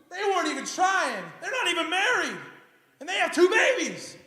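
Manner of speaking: high, loud protest; rising outrage at the unfairness; indignant outburst. Acted. Childless believer comparing himself to others, loud indignant complaint.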